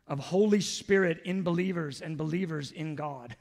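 ordinary delivery

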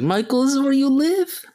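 high surprised retold question. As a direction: act amazed at the discovery. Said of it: "I was like".

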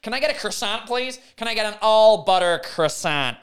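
hammed-up American customer ordering; American accent. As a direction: put on a broad, pushy American accent. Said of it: "Or if you're American, you'll say a croissant".

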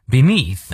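BENEATH ending on a clear TH; tongue-between-teeth TH. Shown as a demonstration. Teacher modelling Beneath, final TH with tongue bitten. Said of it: "最后T H音咬舌".

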